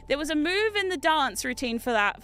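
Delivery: normal talking voice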